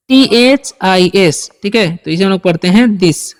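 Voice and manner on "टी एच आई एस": letters spelled out one by one; slow letter-by-letter spelling. Shown as a demonstration. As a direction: spell each letter slowly for the class. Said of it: "teacher spells out letters".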